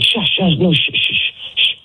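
high, hissed mimicry of shushing; imitated shushing. Man retells his date silencing him, imitated urgent shushing. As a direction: mimic her urgent shushes, mostly voiceless. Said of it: "She's telling me to, to shush".

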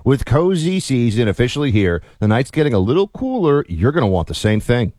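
relaxed host-read ad. Acted. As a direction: pitch the blankets like a friendly plug. Hosts read sponsor ad.

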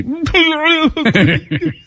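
high-pitched burst of mock bawling; blubbering outburst. Shown as a demonstration. Host showing callers his crying fear, blubbering burst. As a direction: demonstrate a sudden, noisy crying fit. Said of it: "burst out with a".

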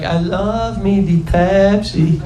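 put-on slow, drawn-out Sofia Vergara accent. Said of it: "saying that with that accent".

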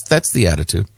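usual speaking voice

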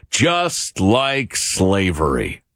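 very slow, low, drawn-out sarcasm; sarcastic punchline. Acted. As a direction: land each word heavily, mock-solemn. Sarcastic punchline to his analogy.

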